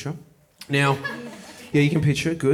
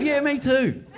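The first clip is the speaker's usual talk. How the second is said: higher, mock-chirpy agreement; neighbour's reply; 10 semitones higher, slower than usual. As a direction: toss it back brightly as her. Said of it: "She, she says".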